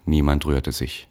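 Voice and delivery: plain reading voice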